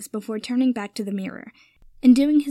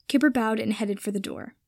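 ordinary delivery

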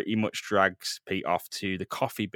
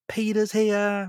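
put-on excited girl; 9 semitones higher, slower than usual. Gwen bursting in.

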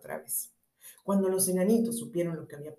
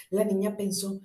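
ordinary delivery

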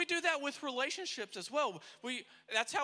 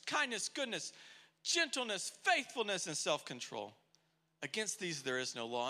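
normal talking voice

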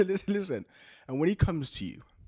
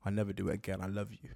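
low, soft mock-sincere boyfriend; hammed-up tender remorse; somber apology; 9 semitones lower, 9 dB quieter than usual. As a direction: apologise low and hushed, milking it. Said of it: "he's just like".

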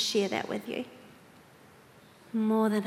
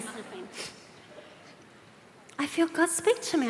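ordinary talk, nothing marked

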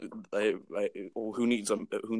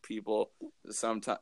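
ordinary delivery

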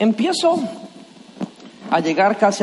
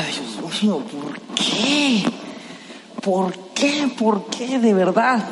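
soft despairing lament; 4 dB quieter, much slower than usual. Retold or imitated. Despairing prayer during last laps.

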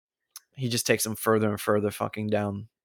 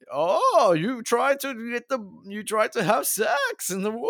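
much higher, slow, hammed-up bad Latin American accent; terrible Latin American accent; 12 semitones higher, slower than usual. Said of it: "terrible accent on".

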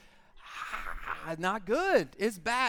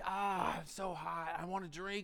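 mock parched; 7 dB quieter, slower than usual. Thirsty ad-like acting.

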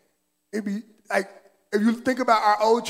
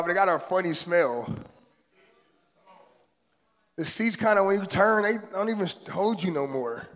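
ordinary talk

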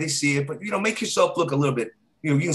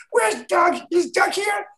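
much higher, pleading little voice; high girl voice; 4 dB louder, slower than usual. Retold or imitated. Circus girl looking for Doug at the club, high small voice. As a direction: call out for Doug in a tiny voice.

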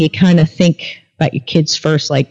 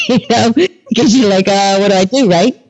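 higher voice through a giggle; laughing; 5 semitones higher than usual. Real, not acted. Storyteller asking what do I do, giggling. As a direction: talk through the tail of a laugh. Laughing at her own dilemma.

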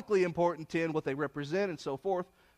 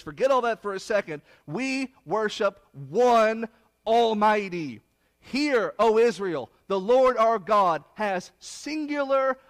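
much louder, proclaiming each word; forceful preaching; 4 semitones higher, 6 dB louder, slower than usual. Acted. Preacher proclaiming the Shema to congregation, loud full-force preaching. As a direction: ring it out like a creed from the pulpit.

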